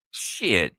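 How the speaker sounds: usual speaking voice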